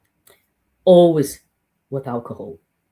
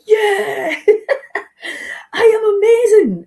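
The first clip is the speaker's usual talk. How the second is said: much higher and louder, laughing through it; ecstatic laughing cry; 8 dB louder, slower than usual. Pretend. After breath work, crying out with laughing delight. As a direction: shout it out, laughing with joy. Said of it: "You're like".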